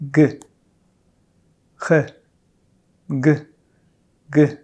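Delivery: g moving into a French throat r; uvular r. Shown as a demonstration. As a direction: slide from g to the uvular r. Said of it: "Let's practice".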